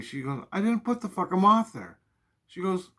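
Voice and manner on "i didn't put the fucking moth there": mom swearing. Retold or imitated. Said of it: "she's swearing at me".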